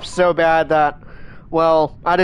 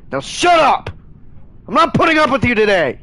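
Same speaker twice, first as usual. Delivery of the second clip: sharp yell, higher in pitch; angry shout; 5 semitones higher than usual. Real, not acted. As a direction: shut them up with a real yell. Streamer scolding arguing chat.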